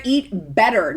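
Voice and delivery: "better" hit loud, vowel "eh" made clear; correcting herself, punching "better" loud; stress on better. Shown as a demonstration. Vlogger fixing her misread note, stressing better. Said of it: "not eat butter".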